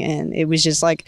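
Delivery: ordinary delivery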